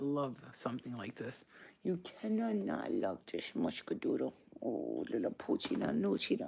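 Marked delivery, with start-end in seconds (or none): 1.8-6.5 doting, mushy pet-talk voice. Real, not acted. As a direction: gush nonsense pet names at your dog. Baby-talks her dog.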